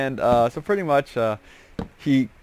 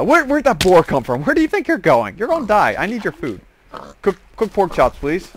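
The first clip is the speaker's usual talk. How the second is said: playful higher mock menace, half laughing; mock threat to a boar; 6 semitones higher than usual. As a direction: tease the boar you're hunting, higher.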